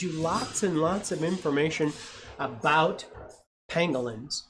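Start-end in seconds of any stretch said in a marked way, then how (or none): none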